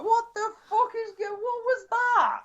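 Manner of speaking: high shrieking what the fuck; startled shriek. Retold or imitated. Friend jolted awake in the car, shrieking in shock. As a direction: screech it like you've been jolted awake. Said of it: "I was like".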